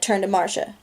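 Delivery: ordinary delivery, nothing marked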